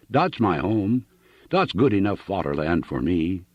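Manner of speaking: put-on earnest heavy German accent; a homely German farmer, sincere and accented. Hooven explains his fatherland to Annixter, German accent.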